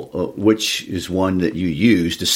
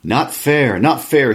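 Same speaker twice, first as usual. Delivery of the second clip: higher, whiny hissing protest; a hint of Gollum complaining; hissed Gollum; 8 semitones higher, slower than usual. Co-host reading Gollum's lines aloud, lightly voiced. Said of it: "he hissed".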